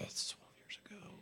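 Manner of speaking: soft unvoiced mutter of the sum; whispered aside. Real, not acted. Working out his age mid-question, in a whisper. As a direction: whisper it to yourself while counting. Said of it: "counting under breath".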